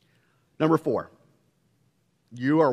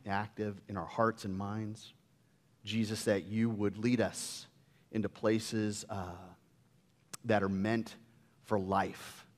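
prayer; 4 semitones lower, 4 dB quieter than usual. Acted. Pastor praying over the congregation, soft and low. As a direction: pray gently, drawing the words out.

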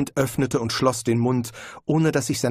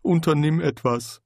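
a lightly voiced nagging conscience; a hint of a guilty inner voice; 5 semitones higher than usual. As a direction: speak as the inner voice, reproachful. Conscience voice in his head.

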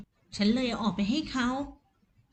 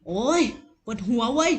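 louder, acted groan of complaint; pained complaint; 3 dB louder than usual. A narrator's reading. Sick husband complaining of a headache, pained grumble. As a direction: grumble out loud in pain. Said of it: "เขาบ่น".

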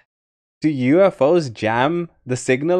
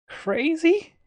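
high questioning; 4 dB quieter than usual. Pretend. Repeating "crazy" in rising tones.

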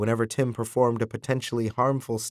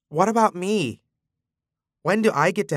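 wavering voice; 8 semitones higher than usual. A narrator's reading. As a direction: voice wobbling, close to tears. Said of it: "His voice wavered".

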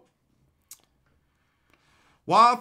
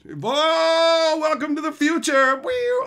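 loud, high announcer wow with a siren; hammed-up welcome; 9 semitones higher, 6 dB louder, slower than usual. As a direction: sell the joke with a siren sound. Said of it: "yeah, I'm saying".

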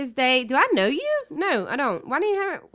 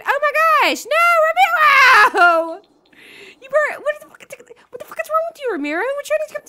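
very high, loud shriek of outrage; shocked outcry; 13 semitones higher, 6 dB louder than usual. Real, not acted. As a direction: scream at the rude sim. Sim walks away heartbroken.

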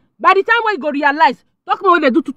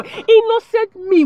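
high, slow self-pitying plea; mock self-pity; 8 semitones higher, slower than usual. Real, not acted. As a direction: stretch it out like a wronged saint. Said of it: "poor me".

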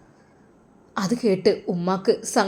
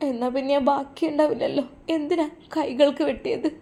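higher and softer, tearful lament; crying; 6 semitones higher, 3 dB quieter than usual. Acted. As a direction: grieve quietly, voice lifted. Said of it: "അവൾ കരയുകയായിരുന്നു". (ഉയർന്ന, മൃദുവായ കരച്ചിൽ ശബ്ദം)

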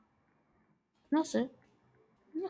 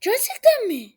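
higher, louder, can't believe the offer; excited disbelief; 9 semitones higher, 8 dB louder than usual. Real, not acted. Streamer stunned that someone offers a pet, excited disbelief. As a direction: gasp it out, thrilled and doubtful.

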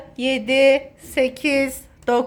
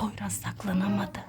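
whispering; 8 semitones lower, 14 dB quieter than usual. Real, not acted. Mother whispers while hiding.

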